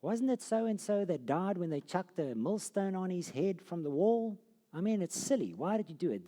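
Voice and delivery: high, loud, exasperated outburst; king flaring up. Retold or imitated. The king scolding his messenger over the lost men, angry outburst. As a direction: blow up at the messenger in disbelief. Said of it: "the king is gonna flare up".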